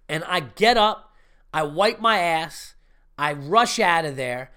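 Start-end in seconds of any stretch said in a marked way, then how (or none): none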